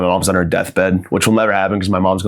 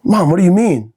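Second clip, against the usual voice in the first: mock alarm; 9 semitones higher than usual. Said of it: "pleading son".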